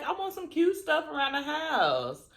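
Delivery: ordinary talk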